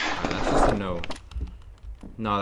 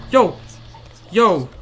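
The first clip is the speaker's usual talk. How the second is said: startled yell; 7 semitones higher, 10 dB louder than usual. Real, not acted. Panicked reaction in game.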